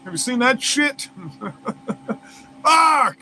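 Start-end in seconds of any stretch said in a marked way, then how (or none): 2.6-3.2 loud, high outburst; sudden shouted expletive; exclaimed curse. Real, not acted. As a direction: blurt it out sharply after a laugh. Alarmed outburst at robot dogs.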